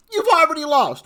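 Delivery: exclaimed incredulously. Real, not acted. Vlogger scoffing at party spending, "already lost" exclaimed high and loud. Repeats the verdict in incredulous emphasis.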